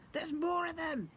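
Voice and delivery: very high fearful goblin voice; horrified squeak. Acted. Goblin prince sees Dad and recoils, horrified squeak. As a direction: squeak it in disgust.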